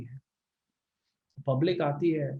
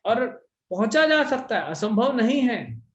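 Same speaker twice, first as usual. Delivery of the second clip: emphatic insistence; 8 semitones higher, 6 dB louder than usual. Real, not acted. Preacher assuring devotees the spiritual level is possible, emphatic.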